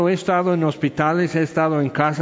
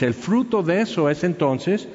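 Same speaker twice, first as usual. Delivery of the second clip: ordinary delivery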